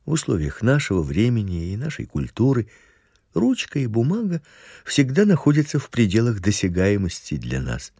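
ordinary talk, nothing marked

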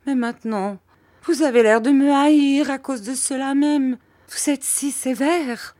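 high, wounded voice; wife reproaches her stern husband, weeping; tearful reproach. A narrator's reading. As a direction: reproach him through tears. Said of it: "qui pleurait à chaudes larmes".

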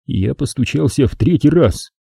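plain reading voice

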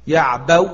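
يعبو stopped on a vowelless waw, ending aw; pure sukun on waw. Shown as a demonstration. Teacher demonstrates pure sukun, يعبو stopped on plain w. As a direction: close يعبو firmly on the w with no vowel after. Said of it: "بالسكون المحض".

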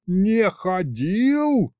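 high, stretched incredulous question; outraged disbelief. A narrator's reading. Boss learning the demon skipped the task, incredulous. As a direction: echo it back in disbelief. Outraged echo of the tsar's news.

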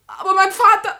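loud, high, pleading outburst; frantic loud pleading; desperate plea. Acted. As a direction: force out the excuse in anguish. Luise pleads her forced lie.

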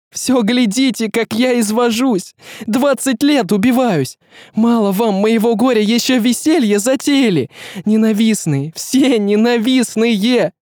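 high shriek near breaking; hysterical screaming. A narrator's reading. Tsaritsa to the hateful crowd, hysterical screaming. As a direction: wail out the hatred at full pitch. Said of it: "Голос её сорвался".

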